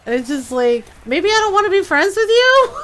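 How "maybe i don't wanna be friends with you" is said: high, loud retort rising at the end; mock indignation. Player answers Solas's offer of friendship, mock indignation. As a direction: throw it back in playful offence.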